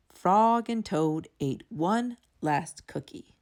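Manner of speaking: plain reading voice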